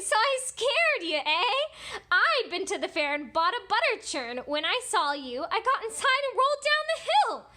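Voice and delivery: high, loud crowing; gloating pig. Acted. Pig boasting to the scared wolf, loud gleeful gloating. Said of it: "laughed the little pig".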